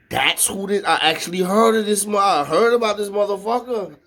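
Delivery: high-pitched retold realization; animated, disbelieving discovery; astonished recognition. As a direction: act out suddenly realizing who it is. Acts out recognizing the new name.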